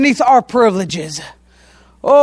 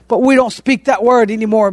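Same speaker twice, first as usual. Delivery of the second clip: ordinary talk, nothing marked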